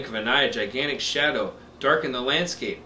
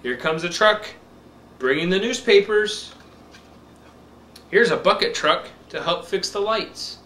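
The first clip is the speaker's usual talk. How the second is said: slow, bright picture-book reading; reading to a toddler; 2 semitones higher, 3 dB louder than usual. Acted. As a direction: read it slow for a little one.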